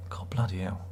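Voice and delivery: breathy whispered swear under the breath; whispered curse. Real, not acted. Reading a harsh comment, a whispered curse. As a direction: hush it like a shocked aside.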